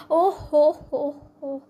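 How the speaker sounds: slow hammed-up fake laugh, loud. A narrator's reading. Noble lady mocking a commoner, fake laughing. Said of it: "वो नकली हंसी हंस रही है".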